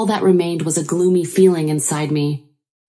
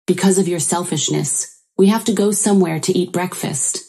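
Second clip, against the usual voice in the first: usual speaking voice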